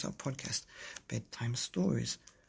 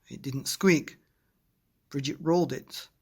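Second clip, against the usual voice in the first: usual speaking voice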